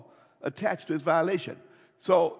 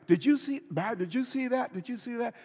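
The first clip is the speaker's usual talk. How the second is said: excited cousin talk; 7 semitones higher than usual. Retold or imitated. Retelling his excitement at Hollywood.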